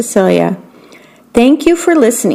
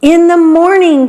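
morning called out high; excited cry; 8 semitones higher, 3 dB louder than usual. A narrator's reading. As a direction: cry it out together like eager beetles. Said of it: "cried all the other beetles".

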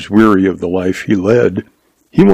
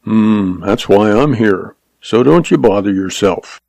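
usual speaking voice

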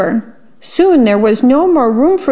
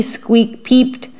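plain reading voice

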